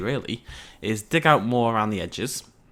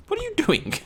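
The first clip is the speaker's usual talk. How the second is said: much higher, surprised outburst; startled squeak. Real, not acted. Player startled by his Pachycephalosaurus, high squeak. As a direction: caught off guard by the dinosaur. Dinosaur does something odd.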